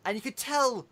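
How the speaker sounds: usual speaking voice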